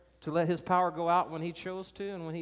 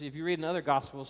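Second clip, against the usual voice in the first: ordinary delivery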